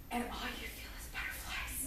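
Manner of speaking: very soft, whispery, love-struck; breathless swoon. Shown as a demonstration. Girl seeing the man she loves, breathless swooning whisper. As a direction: breathe it out almost voiceless.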